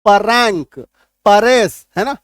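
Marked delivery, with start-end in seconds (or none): 0.1-0.8 परैंक with a vowel between p and r; wrong split reading. Shown as a demonstration. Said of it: "गलत हो जाएगा". 1.3-1.9 परेस punched loud with a vowel after p; wrong split reading. Shown as a demonstration. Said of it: "गलत हो जाएगा".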